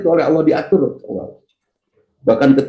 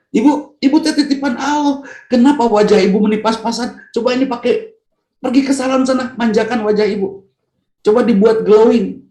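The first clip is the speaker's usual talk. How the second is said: much higher, doting husband voice; husband praising his wife, voice pitched high; 9 semitones higher than usual. Acted. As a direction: pitch way up and fuss over her like a husband.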